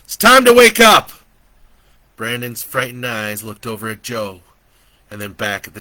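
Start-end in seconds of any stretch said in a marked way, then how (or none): 0.0-1.1 loud high call, stress on time; shout. A narrator's reading. Joe shouts to wake Brandon, loud and high. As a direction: yell it to break the dream. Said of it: "he shouted across the room".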